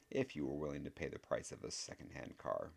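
ordinary delivery, nothing marked

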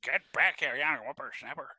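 put-on loud, high cranky old man yelling. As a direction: yell like a cranky old codger with a cane. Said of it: "swing at her violently with my cane".

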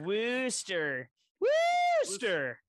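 "Worcester" as WOOOO-ster, long drawn-out vowel; hooted mispronunciation. Pretend. Hooting the Ohio town's spelling.

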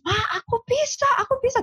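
high-pitched imitation of a tearful athlete; retold tearful cry. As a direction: imitate someone crying out in disbelief, voice lifted high. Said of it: "Sampai dia nangis".

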